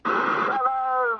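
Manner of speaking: usual speaking voice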